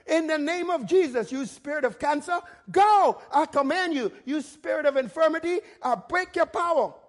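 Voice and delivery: forceful rebuke, punched on GO; bold command. Shown as a demonstration. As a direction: command it out with authority. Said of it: "I talk like this because".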